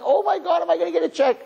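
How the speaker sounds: high, thrilled gasp of hope; excited disbelief. Retold or imitated. Rabbi imagining a big donor check, high and thrilled. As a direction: squeal it like a windfall just landed. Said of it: "I was like".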